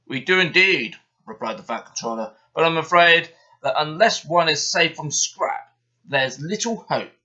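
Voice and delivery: loud, stately boss voice; Fat Controller. A narrator's reading. Fat Controller warning the engines about scrap, loud and grave. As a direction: pronounce it with grave authority.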